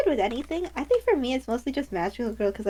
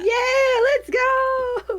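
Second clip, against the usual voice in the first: excited cheer; 11 semitones higher, 4 dB louder, much slower than usual. Real, not acted. Cheering on her turn.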